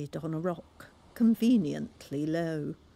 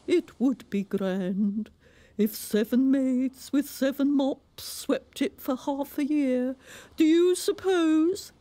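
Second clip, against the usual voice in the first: high, louder storybook walrus; 8 semitones higher, 5 dB louder than usual. A narrator's reading. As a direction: proclaim it like a pompous walrus. Walrus speaks.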